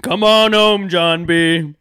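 hammed-up beckoning call; mock Outer Banks call. Mocking show line.

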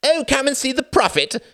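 very high, loud sarcastic taunt; mocking jeer. A narrator's reading. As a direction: jeer like a showman at a freak. Reginald mocking Tom's prophecy.

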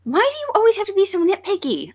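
high, fast, whiny teasing voice; mock whiny complaint. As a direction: whine it like a petulant kid.

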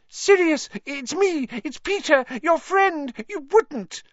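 high, loud panicky squeal; cowering little traitor pleading; grovelling plea. A narrator's reading. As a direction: beg for your life in a high voice. Pettigrew grovels before his old friend.

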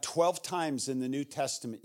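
normal talking voice

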